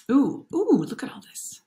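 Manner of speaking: hushed, amazed whisper; awed whisper. Real, not acted. Narrator whispering in awe over a picture book. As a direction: breathe it out in wonder.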